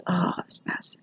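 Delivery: whispered under breath; whispered aside. Real, not acted. Teacher muttering to herself mid-demo, whispered.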